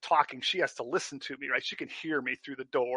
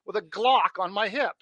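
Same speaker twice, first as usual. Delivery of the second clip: eager mock voice; 8 semitones higher, 9 dB louder than usual. Voicing a gun-carrying Canadian.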